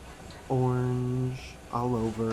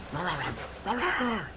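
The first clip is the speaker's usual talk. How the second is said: mock barking, rising higher; imitating a barking dog; 6 semitones higher than usual. Dog barking up at the cat, playful mock barks. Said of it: "Starts barking".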